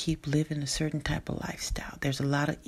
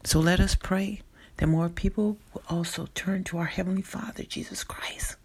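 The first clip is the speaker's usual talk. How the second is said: normal talking voice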